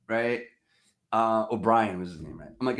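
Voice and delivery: ordinary talk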